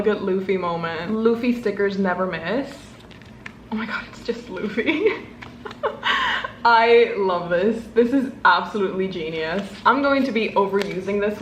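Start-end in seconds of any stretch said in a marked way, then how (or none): 5.1-6.6 breathy high laugh; delighted laugh. Real, not acted. Delighted at the sticker.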